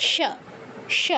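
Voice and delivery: "স" as a soft sh off the palate; palatal sha. Shown as a demonstration. Teacher showing students the palatal sibilant, "স" as sha. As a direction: say "স" as sha, tongue touching the palate. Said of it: "তালব্য স্বরের উচ্চারণটা কি".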